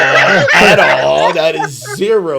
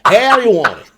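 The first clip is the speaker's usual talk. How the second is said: high, loud mock cowboy hawker; hammed-up Western sales patter; old West salesman; 11 semitones higher, 5 dB louder than usual. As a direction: pitch your wares like an old West peddler. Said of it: "taking it to the old West".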